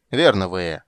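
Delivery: loud shouted reply; loud call. Acted. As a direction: shout back to her. Borch answers Vea's cry.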